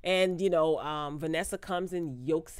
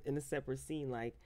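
ordinary delivery

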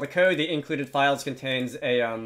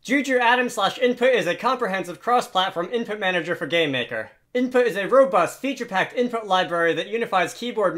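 higher, punchy advertiser patter; mock marketing pitch; 4 semitones higher than usual. Developer parodying an advert to sell game makers an input library, in mock marketing pitch. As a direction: rattle it off like an eager pitchman. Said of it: "uh, pseudo-marketing pitch".

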